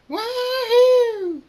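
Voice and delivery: high, loud whoop; long squealing "wahoo" at full voice; screamed wahoo. A narrator's reading. Scootaloo takes off on a flight, screaming whoop. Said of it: "Scootaloo screams".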